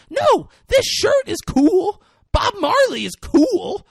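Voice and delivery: high, loud, drawn-out mock indignation; hammed-up shrieky disbelief; acted indignant shouting. [name] argues with the group.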